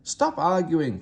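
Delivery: fed-up passengers cutting in; grumbling. Acted. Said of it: "grumbled the passengers".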